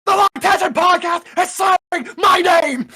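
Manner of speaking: shouted, very high and loud; Keemstar impression. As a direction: yell it like a drama news host. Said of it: "that's my Keemstar impression".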